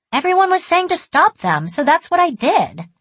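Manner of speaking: put-on high, loud childlike protest; child explaining herself. A narrator's reading. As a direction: justify yourself like a little girl. Child justifies herself to teachers.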